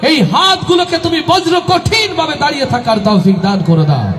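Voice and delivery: high, rising impassioned supplication; fervent prayer. Acted. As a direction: pray aloud with mounting fervour.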